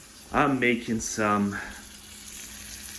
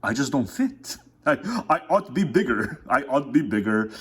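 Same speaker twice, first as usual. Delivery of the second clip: mock lament; 4 semitones higher than usual. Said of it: "It's like".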